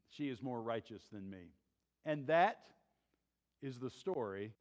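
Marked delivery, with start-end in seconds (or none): 0.1-1.5 hushed repetition. Shown as a demonstration. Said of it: "what the Bible records him saying".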